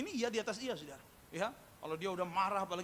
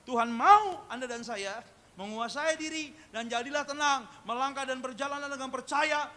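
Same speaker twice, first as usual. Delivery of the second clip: loud, raised exhortation, key words drawn out; forceful preaching; 6 semitones higher, 9 dB louder than usual. Real, not acted. Preacher telling the congregation what God wants, forceful exhortation. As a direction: proclaim it firmly to the whole congregation.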